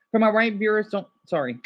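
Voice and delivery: ordinary delivery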